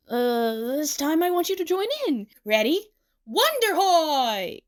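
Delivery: high, rallying stage call; big theatrical whoop; excited showman. Acted. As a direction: rouse the audience to join in, drawing out the cheer. Showman invites plushie to join in.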